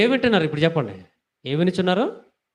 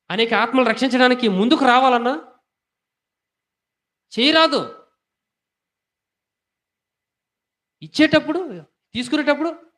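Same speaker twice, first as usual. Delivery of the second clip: high, loud rebuking sermon voice; full-force preaching; 6 semitones higher, 3 dB louder than usual. Acted. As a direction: drive the rebuke home from the pulpit. Preaching climax on giving. (గట్టిగా, ఎత్తైన స్వరంతో గద్దించే ప్రసంగం)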